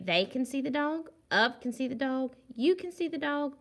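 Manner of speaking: each choice read up high and asking; teacher offering answer choices to the class; testing options. Shown as a demonstration. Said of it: "Should we say".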